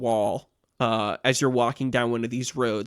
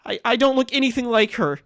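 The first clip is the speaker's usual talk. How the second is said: put-on higher-pitched, pleading girl's voice; pleading young girl; 11 semitones higher, 4 dB louder than usual. Accused girl pleads with a passer-by, pleading. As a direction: plead innocence in a high voice. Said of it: "looks at you with pleading eyes and says".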